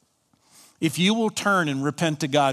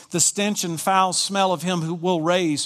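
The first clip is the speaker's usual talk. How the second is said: ordinary talk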